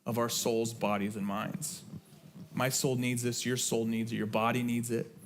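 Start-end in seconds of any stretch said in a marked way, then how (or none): none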